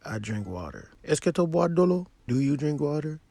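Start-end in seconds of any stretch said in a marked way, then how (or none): 1.0-2.1 Est-ce que tu bois de l'eau? with a high rising question tune. Shown as a demonstration. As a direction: ask it with the voice going up. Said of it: "raise your intonation".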